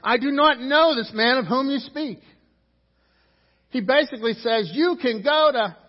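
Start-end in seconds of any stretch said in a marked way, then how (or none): 0.0-2.2 loud, high, vehement acted denial; cursing, swearing denial. Peter denying Jesus to bystanders, cursing and swearing. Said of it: "he begins to curse and swear". 4.7-5.8 loud, high curse, cut short; acted cursing. Peter cursing his accusers, loud and high. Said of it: "And he curses them".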